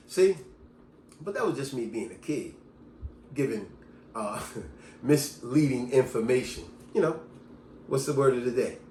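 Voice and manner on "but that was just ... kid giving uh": ordinary delivery, nothing marked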